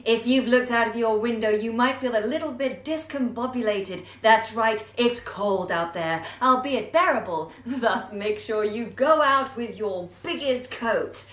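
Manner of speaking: loud, showy newsreader put-on voice; odd presenter voice. Shown as a demonstration. To the audience, a loud hammed-up weather host. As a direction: ham up the host with fancy words. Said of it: "what the heck is going on with your voice".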